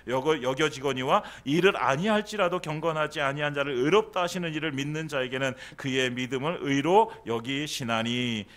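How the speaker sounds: loud, solemn Bible reading; scripture reading. Acted. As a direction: proclaim the verse to the congregation. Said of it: "이렇게 말씀하고 있어요".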